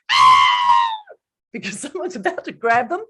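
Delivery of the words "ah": loud demonstrated scream; sudden piercing playground shriek. Shown as a demonstration. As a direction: scream as if about to be grabbed. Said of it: "It's that really loud scream".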